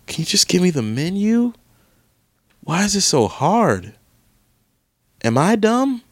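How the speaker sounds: high, whining plea to the website; exasperated pleading. Real, not acted. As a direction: beg the screen in disbelief. Frustrated pleading at menu site.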